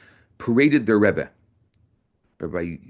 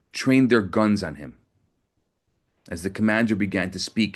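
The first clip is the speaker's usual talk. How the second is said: normal talking voice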